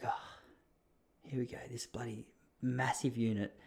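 nervous inner voice. Retold or imitated. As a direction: mutter to yourself in dread. Inner dread about huge tattooist.

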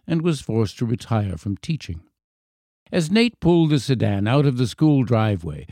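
ordinary delivery

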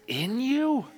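high, rising disbelief; amazed question. Acted. Preacher reacting to Jesus's prayer for oneness, amazed.

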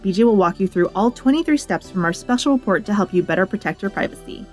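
ordinary talk

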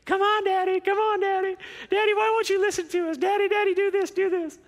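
put-on high, pestering little-girl chant; heckling daughters. Daughters heckling daddy through the door, high and pestering. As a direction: nag like small girls at the door. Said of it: "They stood at the door and heckled me".

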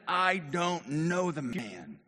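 deliberate, drawn-out disowning; emphatic denial. Acted. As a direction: stretch every word of the denial. Replays Peter's denial.

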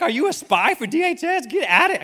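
high, loud hammed-up interrogation; mock accusing shout. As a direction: accuse a child of spying, comically outraged. Mock interrogation of child's friend.

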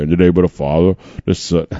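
slightly deep, odd solemn voice; lightly put-on low voice. Shown as a demonstration. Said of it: "I don't know where that voice came from".

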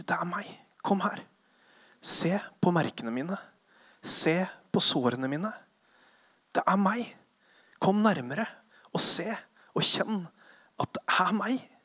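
soft, tender invitation; gentle Jesus. Acted. Jesus calming terrified disciples, soft and tender. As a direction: draw the scared friends near softly. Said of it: "Så han sier".

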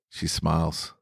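ordinary delivery

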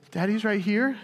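put-on high questioning child's voice, rising at the end; toddler asking. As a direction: echo dad's words like a relieved two-year-old. Toddler answers through monitor.

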